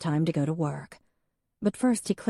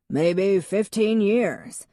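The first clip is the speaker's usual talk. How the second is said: slow, higher, louder with FIFTEEN falling; measured answer; 4 semitones higher, 5 dB louder, much slower than usual. A narrator's reading. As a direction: state it slowly like a know-it-all. Victor answers the question.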